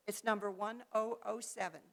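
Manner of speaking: ordinary talk, nothing marked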